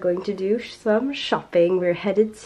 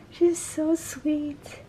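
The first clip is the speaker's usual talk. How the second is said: cooing; 6 semitones higher, 7 dB quieter, much slower than usual. Real, not acted. Cooing over the kitten.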